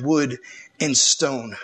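ordinary talk, nothing marked

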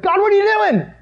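high-pitched, bewildered Jesus crying out to God; mock anguished cry. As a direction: act out a desperate plea, starting high and easing down. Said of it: "sound like Jesus is crying that out".

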